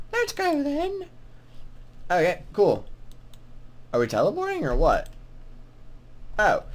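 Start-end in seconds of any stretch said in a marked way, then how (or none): 0.1-1.1 high-pitched mock British woman; badly faked British accent, pitched up; female-voiced British accent. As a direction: put on a higher, hammy British lady voice. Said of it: "that was, uh, horrible regional British accents".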